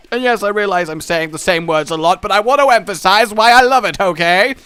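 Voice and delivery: loud vintage broadcaster voice; old-timey TV voice. Pretend. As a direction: announce it like old television. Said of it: "old-timey television voice".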